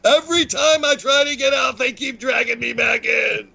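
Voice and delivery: high, hammed-up movie gangster lament; bad Michael Corleone. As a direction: raise the pitch for a bad movie impression. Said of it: "That was such a bad impression".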